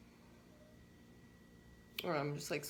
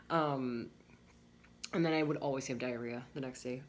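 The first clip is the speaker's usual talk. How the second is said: ordinary delivery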